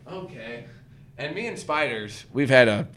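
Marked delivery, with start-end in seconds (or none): 0.1-0.6 hushed. Retold or imitated. Backing away from spider.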